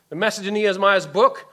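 ordinary talk, nothing marked

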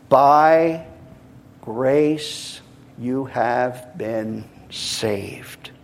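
hushed, drawn-out, word by word; very slow reading. Real, not acted. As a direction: soften and linger on every word. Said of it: "I'm going to read it slowly".

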